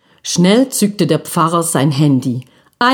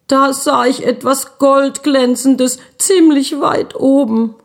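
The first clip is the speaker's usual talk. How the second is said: put-on halting child; 7 semitones higher than usual. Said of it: "Stockend erzählte er".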